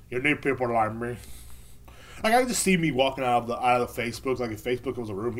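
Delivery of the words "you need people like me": lightly voiced movie gangster; a rough attempt at a gangster accent; Scarface. Banned man to the Facebook crowd, hint of Scarface. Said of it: "can't do a good Scarface impression".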